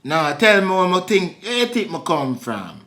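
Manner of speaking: put-on Jamaican patois; Jamaican accent. Asking her to guess his origin in a Jamaican accent. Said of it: "you just doing the accent".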